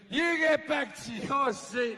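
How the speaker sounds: hammed-up scolding stewardess. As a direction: tell him off like a cross flight attendant.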